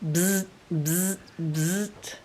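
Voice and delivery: put-on low soft buzzing like an old alarm clock; buzzing alarm imitation. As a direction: imitate the plain buzz. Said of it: "your regular".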